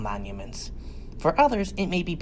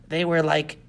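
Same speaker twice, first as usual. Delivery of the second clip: plain reading voice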